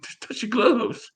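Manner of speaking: sly coaxing. Retold or imitated. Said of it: "You like".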